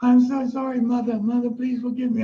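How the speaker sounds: high-pitched tearful begging, imitated; mock bawling. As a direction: beg forgiveness in an exaggerated wail. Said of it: "bawling my eyes out".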